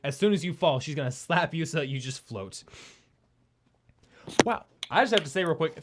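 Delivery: usual speaking voice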